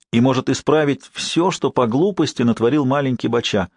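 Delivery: ordinary delivery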